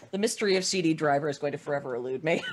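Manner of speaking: ordinary talk, nothing marked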